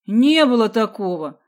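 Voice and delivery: loud, fervent denial; eager, forceful protest; heated exclamation. A narrator's reading. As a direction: deny it hotly. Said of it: "с азартом воскликнул Сидякин".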